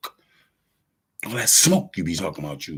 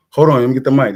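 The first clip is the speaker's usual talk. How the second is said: loud, raised concert call; 6 semitones higher, 11 dB louder, faster than usual. Acted. Performer grabbing the mic at his free concert, calling out. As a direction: shout to take over the mic.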